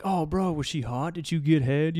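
leering Marine buddies. Retold or imitated. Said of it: "all this insane things Marines think".

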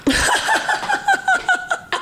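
short real laugh after admitting a flaw; bursting into a laugh; amused laugh. Real, not acted. Laughing at own answer.

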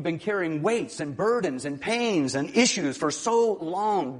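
high, loud, driving sermon voice; fervent preaching. Acted. Pastor to congregation about joy, fervent loud preaching.

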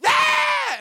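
high piercing imitated shriek; mock metal scream. Imitating the woman screaming in his ear, high shriek. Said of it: "before she went".

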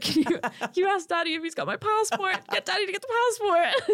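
high-pitched mock pleading down the phone; contestant phoning mom. Retelling her ringing her mom after the boot, high mock urgency. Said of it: "she's ringing her mom and she's like".

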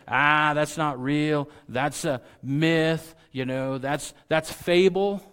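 loud, drawn-out mock scoffing; dismissive skeptic. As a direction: imitate doubters waving it all away loudly. Voicing doubters who dismiss the belief.

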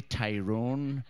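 low, slow mock Tyrone accent. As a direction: imitate Tyrone locals, like a tiger growling low. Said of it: "They say Tyrone like a fucking tiger".

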